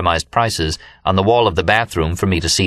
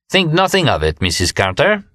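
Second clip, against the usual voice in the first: a grandly courteous gentleman, first words higher; elegant and polite; 7 semitones higher than usual. A narrator's reading. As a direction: play the gracious benefactor with a polite flourish. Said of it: "in his most elegant and polite tones".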